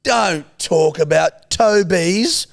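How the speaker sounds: high, slow, exaggerated Kiwi mimicry; mocking Kiwi accent. Co-host mimics host to prove her accent, mocking Kiwi. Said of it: "you went".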